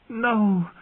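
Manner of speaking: high, anguished cry; shouted. A narrator's reading. Dany dreading the tent, shouted. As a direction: cry out in protest. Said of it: "she shouted".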